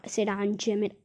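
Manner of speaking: ordinary delivery, nothing marked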